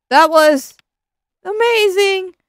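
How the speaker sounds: loud, high delighted cry; thrilled exclaim. Acted. Friend raving about the movie, thrilled. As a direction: gush about it.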